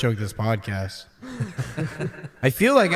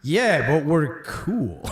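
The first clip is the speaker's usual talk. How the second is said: laughing reply; 2 semitones higher, much slower than usual. Retold or imitated. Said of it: "It was like".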